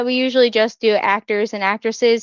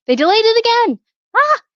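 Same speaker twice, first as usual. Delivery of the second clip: high, louder outburst of dismay; exasperated; 12 semitones higher, 4 dB louder, faster than usual. Real, not acted. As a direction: groan at yet another delay. Exasperated at another game delay.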